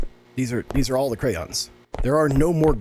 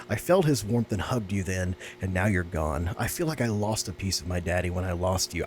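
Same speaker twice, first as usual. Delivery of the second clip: soft, lower letter reading; reading a sad letter; 4 semitones lower, 4 dB quieter than usual. Acted. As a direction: read the child's note gently, a touch sad. Reads diary note aloud.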